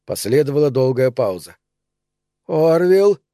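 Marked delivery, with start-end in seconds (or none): 2.5-3.2 calling out in a changed, higher, louder voice. A narrator's reading. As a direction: call the name in a suddenly altered tone. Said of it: "позвал Андерсон изменившимся голосом".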